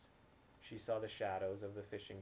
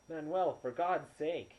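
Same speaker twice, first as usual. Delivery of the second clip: loud, higher pleading cry; urgent shout; 7 semitones higher, 10 dB louder, slower than usual. Acted. Bobby shouts at Manuel to let her save the boy, loud urgent plea. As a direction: shout at him in desperate protest.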